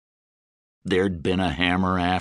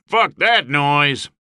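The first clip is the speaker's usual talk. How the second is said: slow, higher heckle; jeering shout; 5 semitones higher, 4 dB louder, slower than usual. A narrator's reading. Mercenaries jeering from everywhere at once, shouted heckle. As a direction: jeer it from the crowd. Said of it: "jeering and shouts of".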